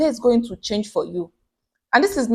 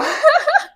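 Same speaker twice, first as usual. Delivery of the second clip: loud high laugh; 4 dB louder than usual. Real, not acted. Laughing at the memory.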